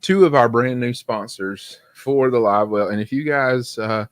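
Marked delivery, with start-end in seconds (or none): none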